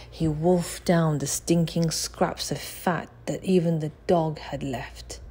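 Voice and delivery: ordinary delivery, nothing marked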